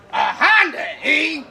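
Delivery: loud high granny squawk; old lady voice. Retold or imitated. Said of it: "she goes".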